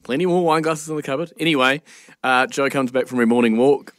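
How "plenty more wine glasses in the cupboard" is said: put-on higher, sweet reassurance to a child; reassuring to child. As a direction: brush it off gently for a kid. Reassuring stepdaughter after broken glass.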